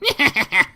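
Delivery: high, loud evil cackle; villain cackle. Acted. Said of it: "I need to cackle a lot".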